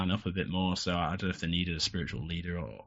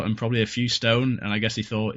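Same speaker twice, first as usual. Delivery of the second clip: ordinary talk, nothing marked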